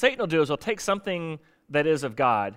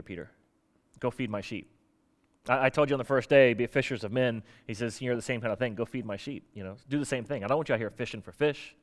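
softer, lower calm reassurance; reassuring; 5 semitones lower, 5 dB quieter than usual. Retold or imitated. Jesus reassuring Peter on the beach, soft and calm. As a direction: lay it down gently and steady.

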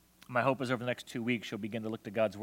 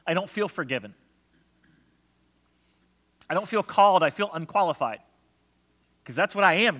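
a hint of a pleading doubter; lightly voiced complaint to God; doubting believer; 7 semitones higher, 9 dB louder than usual. Preacher voicing a doubter's prayer, pleading. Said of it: "the doubter answering God".

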